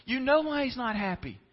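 high, loud mock protest; exasperated retort. Listener answering the preacher's fish question, exasperated retort. Congregation's exasperated reply, acted.

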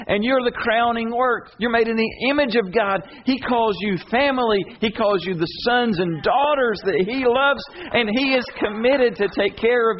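high, impassioned preaching build; soaring sermon voice. Acted. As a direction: lift it up and declare it to the congregation. Preaching rising to full force.

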